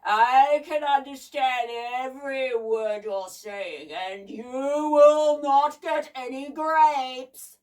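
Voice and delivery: loud, crackly mechanical giant; huge talking machine, crackling; booming crackly voice. A narrator's reading. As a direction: boom it out slowly and harshly. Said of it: "a crackly voice boomed out".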